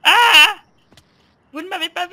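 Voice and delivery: loud sudden yelp then high plea; alarmed shout. Real, not acted. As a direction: scream when caught hiding. Spotted while hiding. (cri surpris quand on le repère)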